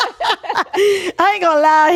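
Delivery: high, loosely drawn-out words through laughter; real laughing. Real, not acted. Recalling boyfriend going pale, laughing hard. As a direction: burst out laughing and keep talking.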